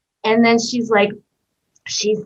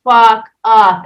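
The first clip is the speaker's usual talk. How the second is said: blunt disbelief; 7 dB louder than usual. Retold or imitated. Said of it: "I was like".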